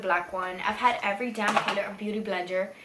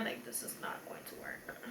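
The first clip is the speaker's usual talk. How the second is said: whispering; 14 dB quieter than usual. Real, not acted. Looking at her makeup.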